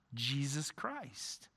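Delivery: soft, slow reverent answer; hushed. Acted. Preacher answering who comforts us, soft and slow. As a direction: drop to a hush and let it land. Answer after building sermon.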